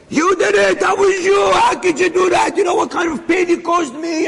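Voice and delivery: high-pitched retold tirade; outraged, high-pitched accusing cries; flipping out. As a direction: flip out at him as the wronged father. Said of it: "he's flipping at him".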